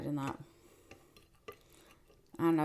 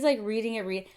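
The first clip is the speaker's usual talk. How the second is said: ordinary delivery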